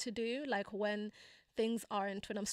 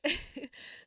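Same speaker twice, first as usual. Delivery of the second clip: light laugh; 6 semitones higher, 3 dB louder than usual. Real, not acted. Laugh after retold exchange.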